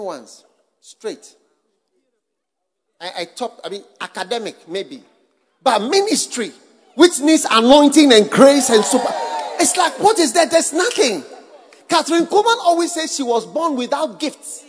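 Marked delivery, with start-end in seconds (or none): 5.6-12.3 preaching shout. Acted.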